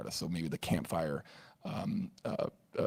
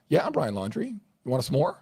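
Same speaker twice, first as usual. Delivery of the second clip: carefree Brian; 7 semitones higher, 7 dB louder than usual. Pretend. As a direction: pretend Brian, higher and louder, offering a snack. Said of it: "And Brian responds and says".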